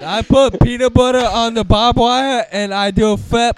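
put-on goofy kid voice. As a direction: brag like a dopey kid. Parodying the kid's video.

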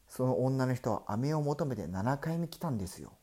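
usual speaking voice

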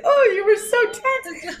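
high, laughing imitation of a soothing remark; laughing mock reassurance. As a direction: giggle through it in a mock sweet voice. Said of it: "It was like".

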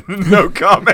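high, loud, stretched-out repeat; amused echo. Retold or imitated. Repeating the no comment for laughs, amused. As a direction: throw it back loudly, high and slow.

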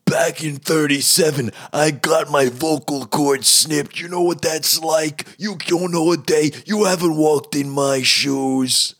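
high, strained, shouted cop parody; insane detective voice. Acted. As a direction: rant as if the vocal cords were snipped. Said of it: "talking like this".

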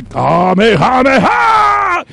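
slow, drawn-out, loud Kamehameha shout; slow drawn-out battle cry; anime attack shout. Acted. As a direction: charge and fire like an anime hero. Monster firing energy beam.

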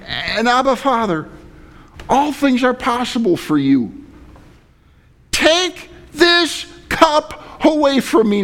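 high, pleading prayer to a father; desperate pleading. Acted. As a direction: beg like a son pleading with Dad. Jesus pleading with his Father.

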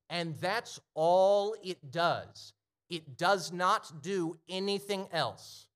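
preaching at full force. Acted. Preacher driving home the gospel to the congregation, full-force preaching. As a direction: hammer each line home, slow and big. Climax of the sermon.

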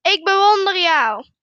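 loud answering shout; echoed call. A narrator's reading. Mountain voice answers the father, loud echoed call. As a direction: call it back like an echo. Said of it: "De stem antwoordt".

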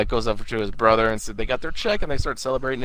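ordinary delivery

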